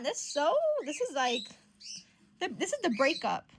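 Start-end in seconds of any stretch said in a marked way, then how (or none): none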